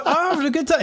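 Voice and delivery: very high, squeaky-pitched insistence; mock protest. Telling someone he really is enjoying himself, high mock protest. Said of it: "It just doesn't look".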